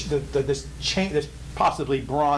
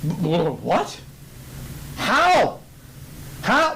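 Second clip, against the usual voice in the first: stammering shock; 4 semitones higher, much slower than usual. Acted.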